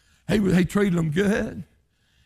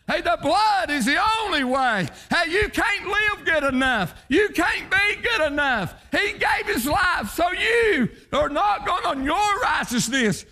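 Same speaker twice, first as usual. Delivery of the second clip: preaching at full force; 13 semitones higher than usual. Acted. Preacher shouting to his congregation about sinners, high whooping preaching. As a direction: ring it out over the congregation.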